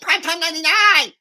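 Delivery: shrieking mocking impression, high and loud; Alex Stein. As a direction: screech it like a deranged caricature. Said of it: "Here's my impression of Alex Stein".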